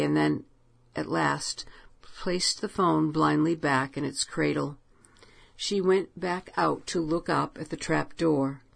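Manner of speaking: usual speaking voice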